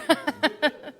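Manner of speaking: a quick bright burst of laughter; amused laugh. Real, not acted. Minister laughing at the slide on screen, light laugh. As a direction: laugh it off, caught off guard.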